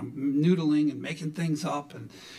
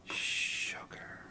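soft, drawn-out whisper; hushed, low coaxing whisper; close whisper; 7 semitones lower, 8 dB quieter than usual. Retold or imitated. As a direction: whisper a pet name into a sleeper's ear. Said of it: "get a little closer to her".

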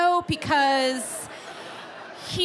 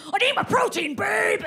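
mock gym bro shout; 9 semitones higher than usual. Trainer boyfriend shouting for his protein at a dinner party. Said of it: "being like".

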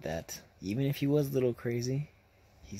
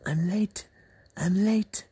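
insistent, louder each time, rising at the end; man staring down a seated woman, growing louder; mimicking the man in her face; 7 semitones higher, 8 dB louder, slower than usual. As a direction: loom over her and insist. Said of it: "stares in her face like".

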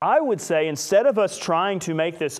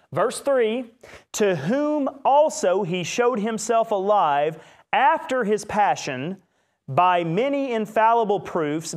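scripture reading; 5 semitones higher than usual. Acted. Reads Acts 1 aloud.

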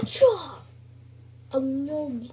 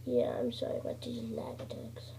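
whisper; 9 dB quieter than usual. Real, not acted. Apology to viewers, whispered.

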